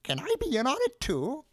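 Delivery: put-on very high, eager old-man voice; excited pleading. A narrator's reading. Old man begging into the secret, excited. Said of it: "said Mister Ollivander excitedly".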